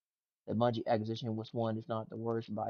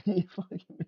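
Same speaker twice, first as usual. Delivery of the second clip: cursing through a burst of laughter, higher and louder; laughing curse; 8 semitones higher, 5 dB louder than usual. Real, not acted. Streamer laughing at a clip, cursing through a laugh. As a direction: laugh it out mid-swear.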